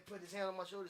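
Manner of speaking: ordinary delivery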